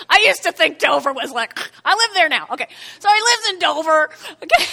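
loud, laughing call-out about Dover; excited loud joking. Real, not acted. Pastor jokingly shouts to the congregation about Dover, excited and loud. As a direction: play it up loud for the crowd.

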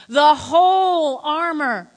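"whole" loud and stretched out; emphatic scripture reading. Real, not acted. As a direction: lean long and hard on "whole". Emphatic scripture reading, repeating the command.

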